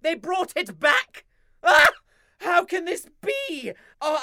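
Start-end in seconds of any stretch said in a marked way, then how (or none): 1.6-1.9 anguished cry. Acted.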